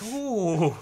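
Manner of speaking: high mock shocked ooh; Jester voice. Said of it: "Jester's like".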